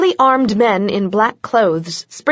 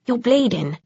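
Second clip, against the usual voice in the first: concerned; 3 semitones higher, 3 dB quieter than usual. A narrator's reading. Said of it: "concern creasing his face".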